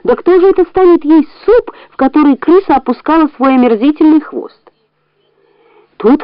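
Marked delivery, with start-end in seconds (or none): 0.0-4.6 high, appalled question; wife's disgusted cry. A narrator's reading. The wife protests to her husband, high and revolted. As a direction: squeal it out in revulsion.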